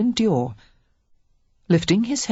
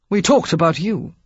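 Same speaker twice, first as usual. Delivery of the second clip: abrupt; 4 dB louder than usual. A narrator's reading. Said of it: "he said abruptly".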